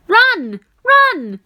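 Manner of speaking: high, loud repeated shout. A narrator's reading. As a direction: shout the warning hard. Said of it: "it shouted again".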